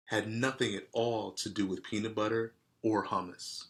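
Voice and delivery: plain reading voice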